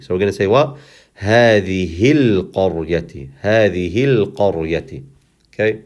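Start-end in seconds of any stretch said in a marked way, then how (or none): 1.2-5.0 هذه القرية joined as hadhihil-qaryati; connected words. Shown as a demonstration. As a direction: skip the a of al, linking هذه straight into القرية. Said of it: "connect this last word to this word".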